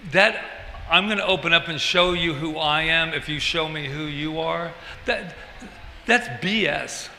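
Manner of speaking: ordinary delivery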